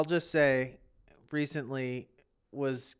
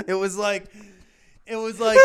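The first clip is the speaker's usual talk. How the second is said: laughing; 4 semitones higher, 5 dB louder, faster than usual. Real, not acted. Laughing through painful memory.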